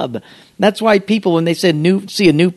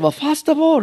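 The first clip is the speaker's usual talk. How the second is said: much higher, imitated Japanese accent; Mister Miyagi; 12 semitones higher than usual. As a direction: answer as a mock bonsai master. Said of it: "And he said".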